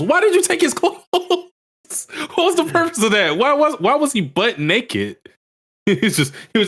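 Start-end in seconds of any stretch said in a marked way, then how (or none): 0.0-5.2 high, fast disbelieving cry; incredulous. Real, not acted. Incredulous rant about naked character.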